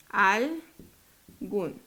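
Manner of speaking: "Algún" split as "al" and "gún", long gap; syllable by syllable. Shown as a demonstration. Teacher divides the next example, "Algún" syllable by syllable. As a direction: break "Algún" into two separate syllables. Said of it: "Separémosla".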